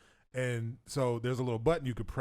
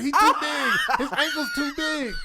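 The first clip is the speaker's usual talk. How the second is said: laughing shout; 12 semitones higher, 9 dB louder than usual. Retold or imitated. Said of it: "And they're like".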